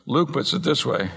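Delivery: ordinary delivery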